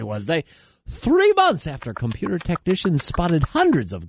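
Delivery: high, punchy comedy newscast; mock news read. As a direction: deliver it like a satirical anchor. Reads mock news items.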